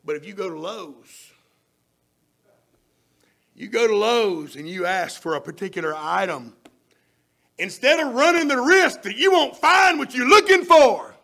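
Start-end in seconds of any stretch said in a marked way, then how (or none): 3.5-11.1 loud and rising, building the point; preaching at full force. Acted. Preacher building his point to the congregation, loud and rising. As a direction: drive it home to the congregation.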